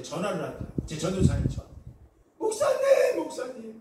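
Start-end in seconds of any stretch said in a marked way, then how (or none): 2.4-3.8 high, urgent cry for the pastor; retold panicked phone call; frantic calling out.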